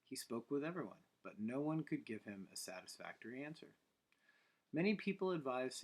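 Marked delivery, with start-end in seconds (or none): none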